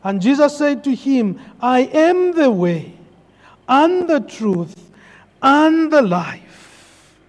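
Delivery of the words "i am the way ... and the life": each phrase slower, the last drawn out; slow solemn quote. Retold or imitated. Pastor proclaiming Jesus' words, slowing solemnly. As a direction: let the verse slow to a solemn close.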